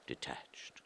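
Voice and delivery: usual speaking voice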